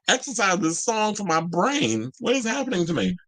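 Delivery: high-pitched mock alarm; mock despair. Mock plea to be exorcised.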